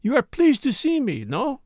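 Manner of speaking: a hint of French, coy and teasing; lightly voiced French woman, teasing her old lover; lightly French-accented Morel. Morel speaks, French lilt.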